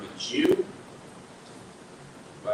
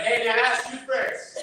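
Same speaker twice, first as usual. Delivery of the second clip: yelling back; 11 semitones higher, 4 dB louder than usual. Retold or imitated. Yelled back across street.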